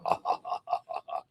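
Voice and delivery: hushed, hammed-up villain chuckle; soft mock evil "ha, ha, ha"; lightly played mastermind cackle; evil mastermind laugh. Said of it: "I've been evil mastermind this whole time".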